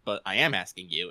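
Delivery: low, soft, dry reply; Goblin Slayer deadpan. Acted. Hero answering his exasperated party, deadpan. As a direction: drop down and answer without emotion. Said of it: "just how fucking deadpan Goblin Slayer is".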